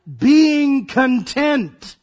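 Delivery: drawn out very slowly; slow reveal. Real, not acted. Preacher revealing the verse's meaning, slowly. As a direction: let each word land slowly.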